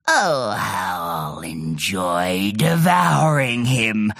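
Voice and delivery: low, slow relishing groan; gloating monster. A narrator's reading. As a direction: savour the thought of eating.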